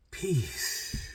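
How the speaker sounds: softly drawn out, trailing into breath; imitating a soft, calming exhale; imitated calm. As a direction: release the word gently, like a sigh. Demonstrates the calming closing word.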